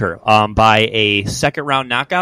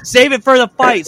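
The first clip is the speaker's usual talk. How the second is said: much higher, raised voice, pulling fighters apart; loud breaking-up shout; 12 semitones higher, 5 dB louder than usual. Retold or imitated. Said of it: "He's like".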